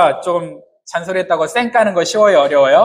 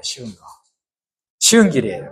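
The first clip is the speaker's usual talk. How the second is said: ordinary delivery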